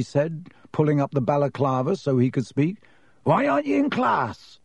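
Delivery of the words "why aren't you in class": very high-pitched, hearty question; Hagrid, surprised. A narrator's reading. Groundskeeper finding Harry out of class, surprised. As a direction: call it out in surprise.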